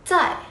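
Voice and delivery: "Tại" driven firmly downward; strong falling tone. Shown as a demonstration. Teacher showing students tone four, "Tại" pushed strongly down. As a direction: hit "Tại" hard and let it fall. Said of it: "Đọc mạnh xuống nhỉ?".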